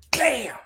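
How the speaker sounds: sharp high yelped bam. Retold or imitated. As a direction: mimic a rock smacking the wall. Imitating the rock hitting the wall.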